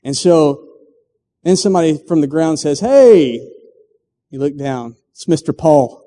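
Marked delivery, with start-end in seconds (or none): 2.8-3.4 calling out. Acted. Paul calls from ground.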